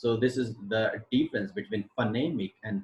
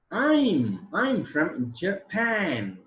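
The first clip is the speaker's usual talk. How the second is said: very slow, higher-pitched, stretched words; slow demonstration reading; 7 semitones higher, 3 dB louder, much slower than usual. Shown as a demonstration. Teacher repeating the example sentence for students, very drawn out. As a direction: draw out each word of the example answer. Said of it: "is the sentence transcription".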